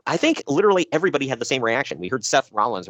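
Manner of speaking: ordinary talk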